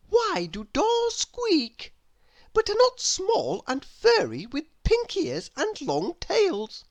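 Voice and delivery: put-on a curious little girl asking sweetly; little Miss Curious voice. Narrator voices Miss Curious asking her door. Character voice.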